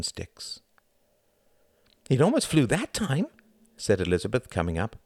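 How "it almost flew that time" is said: put-on high-pitched girl's voice; girl character. A narrator's reading. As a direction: speak high, as the excited girl. Narrator voices girl in story.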